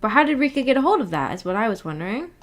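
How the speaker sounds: normal talking voice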